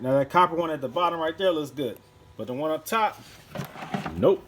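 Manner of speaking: ordinary talk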